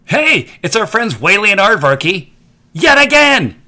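bright, loud, high welcome; cheery kids-show host hello; excited greeting. Acted. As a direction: greet the audience with big excitement.

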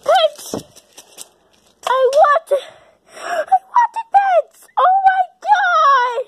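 very high, drawn-out squeal; ecstatic. Acted. Character getting the pets they wanted, ecstatic. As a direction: squeal it with gasping joy.

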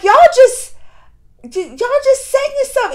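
high, loud, exasperated cry at the screen; exasperated yell. Retold or imitated. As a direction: yell at the characters, fed up. Yelling at the characters.

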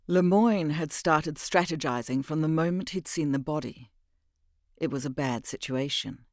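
ordinary delivery, nothing marked

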